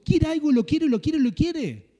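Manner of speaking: a pastor aping his flock's stubborn wanting, loud and high; mimicking craving. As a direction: act out someone who just has to have it.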